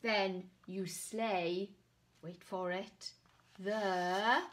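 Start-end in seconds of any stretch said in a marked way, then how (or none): none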